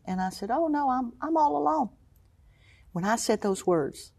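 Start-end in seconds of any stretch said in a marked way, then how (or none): 0.5-1.9 high, light reassuring voice; lightly retold, high-pitched denial; breezy reply. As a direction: brush it off in a higher pitch. Retells her breezy answer to counselor.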